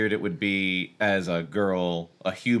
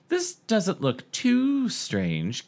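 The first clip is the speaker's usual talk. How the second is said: joking; 9 semitones higher, much slower than usual. A narrator's reading. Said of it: "Cassie joked".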